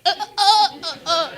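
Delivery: very high, loud squeaky pain cries; dolphin pain squeaks. Retold or imitated. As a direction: squeak like you're talking to dolphins. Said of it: "this impersonation from the pain".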